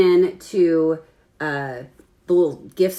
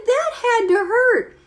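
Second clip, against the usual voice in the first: blurted sympathy; slower than usual. Retold or imitated. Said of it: "I said".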